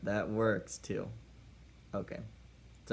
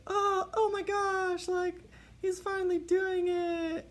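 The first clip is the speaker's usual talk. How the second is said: very high, slow falsetto swoon; swooning girl; slower than usual. Retold or imitated. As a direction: melt like she's finally being kissed. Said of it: "she'll be so like".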